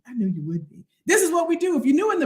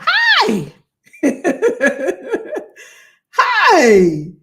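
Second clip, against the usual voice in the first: excited hello; 4 semitones higher, 8 dB louder than usual. Real, not acted. Greeting viewers.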